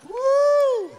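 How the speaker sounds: high, loud "woo"; showman's crowd-rousing whoop; excited whoop. Real, not acted. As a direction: let out a high held whoop of delight. Whooping over a fish shown.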